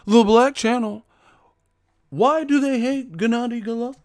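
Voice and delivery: very high mocking voice; whining fans. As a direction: imitate indignant fans in a silly high voice. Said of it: "That's all they say".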